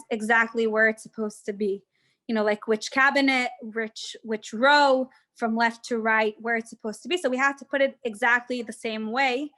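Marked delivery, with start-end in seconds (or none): none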